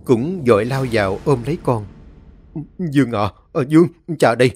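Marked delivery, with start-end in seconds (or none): 2.8-4.5 begging, building higher and louder; a father crying out to his son, rising to a desperate plea; father desperately begs son, building to a cry; desperate pleading. Acted.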